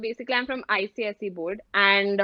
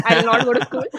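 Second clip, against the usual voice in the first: louder, mock-stubborn declaration; retold defiant refusal; 7 dB louder, faster than usual. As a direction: announce it loudly, as if putting your foot down. (loud, playful retold refusal)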